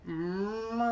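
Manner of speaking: long low moo; cow moo. Shown as a demonstration. Storyteller shows the cow sound, low drawn-out moo. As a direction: chin to chest, moo deep and long. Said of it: "lower our chin to our chest and we go".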